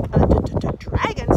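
loud, high startled stutter; stammering. A narrator's reading. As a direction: stutter on the first sound in alarm. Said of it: "stammered his father".

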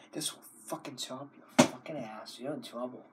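a sinister hushed menace; whispered threat. Acted. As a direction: whisper This will fucking chop your fucking ass.

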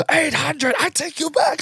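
high-pitched put-on screech, held back in volume; retold screaming. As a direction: imitate a screaming driver in a high squeal. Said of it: "screaming, screaming".

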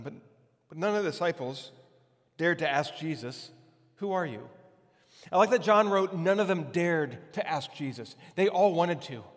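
ordinary delivery

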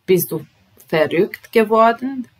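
loud, high verrückt geworden; shocked question, loud and high. Shown as a demonstration. Teacher acts out asking if someone went crazy, loud and high. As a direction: sound shocked at a friend.

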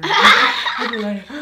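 loud laughing gasp, slow; gasping for air. Retold or imitated. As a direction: act out gasping for breath while laughing. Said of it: "trying to get some air".

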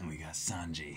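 a hint of a suave cook; Sanji voice. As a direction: croon the name low and smooth. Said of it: "our voices for them".

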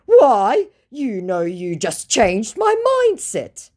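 high, pleading mock voice of the scammer. Reading the scammer's message aloud in a high mock voice.

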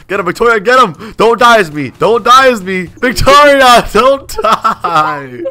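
high, very loud pleading; panicked gamer yell, drawing out the name; frantic shouting. Real, not acted. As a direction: yell desperately to your teammate. Urging girlfriend mid-fight as she dies.

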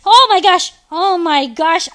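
loud, startled exclamation; sudden loud game panic; alarmed outburst. Real, not acted. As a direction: blurt it out loudly in alarm.

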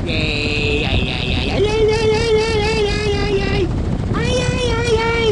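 high choppy robot voice, fan-chopped; robot voice through a fan. Shown as a demonstration. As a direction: talk into a spinning fan like a robot. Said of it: "you talk like a robot".

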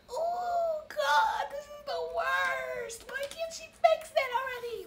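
very high, squealed mock wail; hammed-up tearful squeal of despair; crying poop. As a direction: wail it in a tiny strained voice, stretching the words. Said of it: "it's crying, it's crying".

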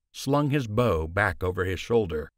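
usual speaking voice

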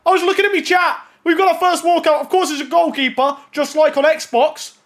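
high-pitched, thrilled gamer reaction; excited outburst. Real, not acted. Gamer telling chat about the first walkout, excited. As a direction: blurt it out high, amazed at your luck.

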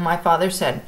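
ordinary delivery

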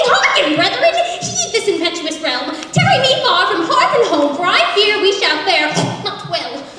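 high, loud mock-heroic proclamation; pompous pig. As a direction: declaim it like a ham Shakespearean actor. Pig voices grandiose warning.

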